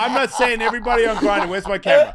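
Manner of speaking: high-pitched talk through laughter; laughing. Real, not acted. Defending himself to friends while laughing hard. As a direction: try to finish the point while cracking up.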